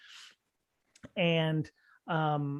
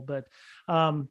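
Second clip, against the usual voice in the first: ordinary talk, nothing marked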